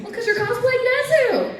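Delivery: high, excited retort; insistent fan. Retold or imitated. Audience member answers the puzzled panelist, high and insistent.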